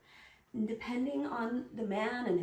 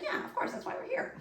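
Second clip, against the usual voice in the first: casual quip; faster than usual. Retold or imitated. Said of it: "You might just be like".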